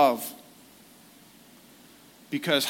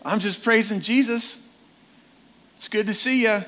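a lightly voiced, higher, easygoing old man; casual and chummy, pitched up; cheery grandfather; 6 semitones higher, faster than usual. Grandfather greeting grandson in heaven, cheery and higher. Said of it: "And he'd be like".

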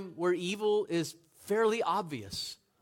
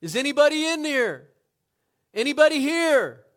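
much higher and louder searching call; 11 semitones higher, 13 dB louder than usual. Retold or imitated. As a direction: call into the dark smoke, voice raised high. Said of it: "He called out".

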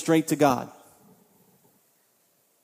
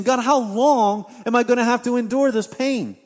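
higher, louder pleading cry; anguished plea; 5 semitones higher, 4 dB louder than usual. Acted. Habakkuk crying out to God, higher, louder anguished plea. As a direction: plead with God through the pain. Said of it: "And he's saying".

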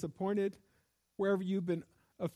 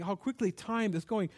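ordinary talk, nothing marked

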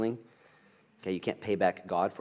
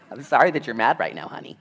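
mock spouse apology; 4 semitones higher, 8 dB louder than usual. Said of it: "You ever said that before?".

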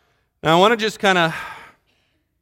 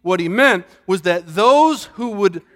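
ordinary delivery

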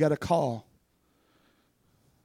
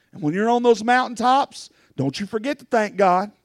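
usual speaking voice

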